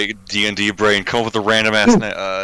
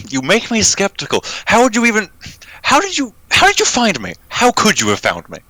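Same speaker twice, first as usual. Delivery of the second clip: higher, tense distrustful voice; suspicious character voice; 12 semitones higher than usual. Acted. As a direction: press him, high and wary.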